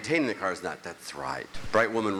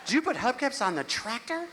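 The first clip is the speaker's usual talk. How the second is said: much higher, accusing; high wife voice; 12 semitones higher than usual. Retold or imitated. Wife asking husband about the tractor hubcaps, higher and accusing. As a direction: play the suspicious wife.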